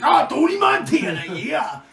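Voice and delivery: high, loud hyped yell; mock coked-up shout. Friend at the pile of coke, mock Scarface bravado. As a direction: holler it, imitating wild bravado. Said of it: "He's Scarface".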